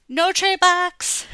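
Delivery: high, loud and slow exclamation; excited shout. Acted. Child shouting with excitement after reading the book. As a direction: shout it out with glee.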